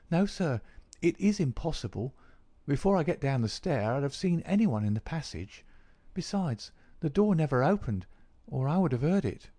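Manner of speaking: high, emphatic maid's voice; maid's testimony. A narrator's reading. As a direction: answer as a servant, pitched well above the narration. Voices the maid Susan.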